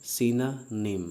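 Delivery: Synonym stretched very slowly as "SIN-a-nem"; drawn-out synonym. Shown as a demonstration. Teacher drilling the class: Synonym, very slowly. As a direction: draw out Synonym syllable by syllable. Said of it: "इसको सिनोनिम नहीं पढ़ते हैं".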